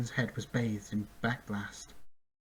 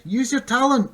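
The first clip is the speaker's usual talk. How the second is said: loud, much higher shout; crying out; 12 semitones higher, 10 dB louder than usual. A narrator's reading. Bink to the griffin, a loud high shout. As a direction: yell the order over the danger. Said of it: "Bink cried".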